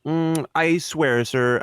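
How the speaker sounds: ordinary talk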